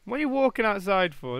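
ordinary talk

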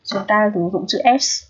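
ordinary talk

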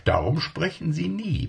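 plain reading voice

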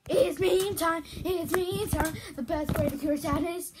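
loud, high-pitched mock intro; hyped catchphrase. Vlogger imitating Jacksepticeye's meme time intro, loud and hyped. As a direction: belt out the intro with hype.

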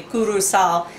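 Curacao said as "KOO-roo-sow"; island pronunciation. Shown as a demonstration. Vlogger tells viewers the true name, Curacao pronounced "koo-roo-sow". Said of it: "real quick on the pronunciation of this".

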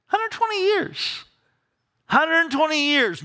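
hundred twenty years, called out high; emphatic repeat. Real, not acted.

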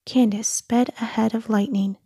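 usual speaking voice

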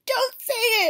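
high, loud panicked plea; mock horrified plea. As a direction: beg him not to say it. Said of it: "She's like".

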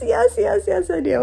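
high-pitched string of eager yeses; eager, excited yes. Retold or imitated. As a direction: reenact jumping at the offer. Retelling eager yes to baby call.

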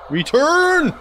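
high, eager call-out; excited. Real, not acted. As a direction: shout the command with glee. Excitedly commanding the game.

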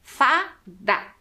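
"Fada" broken into FA and DA with a pause; split into syllables. Shown as a demonstration. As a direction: sound out "Fada" in two strong beats. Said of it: "o D e o A faz da".

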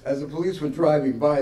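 normal talking voice

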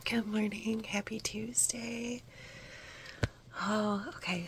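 hushed ASMR whisper over a gift package; whispered unboxing. Real, not acted. Whispering to viewers while opening a yarn gift box. Whispered morning vlog greeting.

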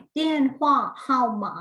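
"เตี้ยนหว่าเฮาหมา" projected and distinct; pronunciation drill. Shown as a demonstration. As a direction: model "เตี้ยนหว่าเฮาหมา" clearly for learners. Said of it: "ทวนการออกเสียงอีกครั้งหนึ่ง".

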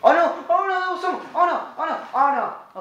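high panicked cries. Real, not acted. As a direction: yelp in panic, over and over.

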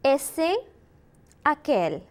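words spaced out with long pauses; repeat-after-me drill. Shown as a demonstration. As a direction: model each word for a class to echo.

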